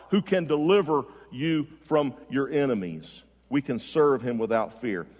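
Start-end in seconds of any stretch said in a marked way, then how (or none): none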